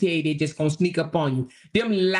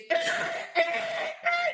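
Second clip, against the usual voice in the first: high squealing scrape noise; imitated car scraping; 5 dB quieter than usual. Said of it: "All I'ma hear is".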